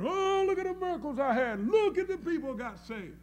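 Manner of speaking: high-pitched parody of a show-off; mock bragging. Preacher mimicking one who comes back bragging, mock boasting. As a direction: imitate a boaster crowing over miracles. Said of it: "Come back and start bragging".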